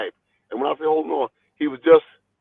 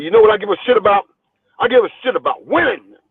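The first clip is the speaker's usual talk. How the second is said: higher, louder and fast; forceful promoter lecture; 6 semitones higher, 5 dB louder, faster than usual. Retold or imitated. Promoter lecturing the boxer about winning, loud and fast. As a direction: rattle it off with force, driving the point home.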